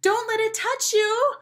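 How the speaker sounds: put-on very high, loud little-girl voice; mock-frightened schoolgirl shriek; shrill warning. A narrator's reading. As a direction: cry out a panicked warning. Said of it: "warned a girl with pink glasses".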